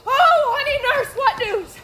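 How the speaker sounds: high, loud burst of excitement; eager greeting. Acted. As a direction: rush at her, desperate for news. Juliet greets the returning nurse.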